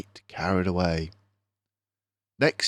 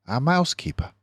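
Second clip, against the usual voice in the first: ordinary delivery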